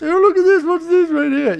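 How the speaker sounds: high, excited mock discovery; mock surprise. Showing viewers the plugin, high mock surprise. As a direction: gasp it out like a kid finding a toy.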